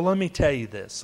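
normal talking voice

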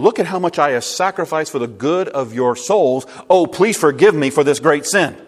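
loud mock pleading, hammed-up; mocking, sarcastic. Paul to his critics in Corinth, mocking them to wake them up. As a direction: lay on a sarcastic apology, rising in volume. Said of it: "He's mocking them".

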